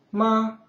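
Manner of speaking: MA high, long and level; first tone. Shown as a demonstration. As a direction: hold MA on a high, even note.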